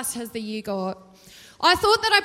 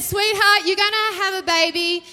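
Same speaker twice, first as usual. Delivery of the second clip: higher and louder, a bold proclamation; angel's loud announcement; 6 semitones higher, 7 dB louder than usual. Retold or imitated. Angel announcing the baby to Mary, loudly. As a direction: boom it out like a heavenly messenger. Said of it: "So he said".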